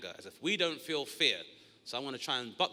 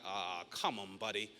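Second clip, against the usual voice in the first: tense mutter; 7 semitones lower, 7 dB quieter, slower than usual. Retold or imitated.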